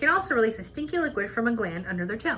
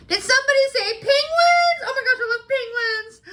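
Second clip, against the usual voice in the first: high, loud squealing penguin fan; excited shout; 12 semitones higher, 6 dB louder than usual. Acted. Fan yelling at the pangolin about penguins, high and thrilled. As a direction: burst in shrieking with delight. Penguin-crazy character bursting in.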